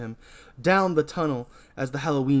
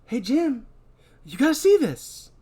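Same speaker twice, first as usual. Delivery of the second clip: higher voice calling to Jim; Donna calling out; 12 semitones higher, 4 dB louder than usual. Acted. Donna calling Jim over to see something. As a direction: call him over, curious. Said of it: "Donna calls out".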